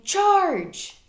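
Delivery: high, loud battle cry; loud rallying yell; yelled charge. A narrator's reading. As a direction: charge in like a tiny hero.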